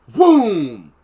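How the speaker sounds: loud imitated boom from the garage. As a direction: slam out the crash sound. Loud noise heard at night.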